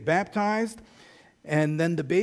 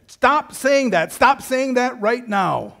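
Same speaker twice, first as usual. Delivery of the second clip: put-on higher and louder, slow, furious child; angry yell; 7 semitones higher, 7 dB louder, slower than usual. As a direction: shout the order at dad. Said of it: "he yelled at me".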